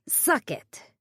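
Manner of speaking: Margot, sassy, dismissing the investors; cheeky. A narrator's reading. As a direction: toss off the crude punchline. Margot's crude punchline.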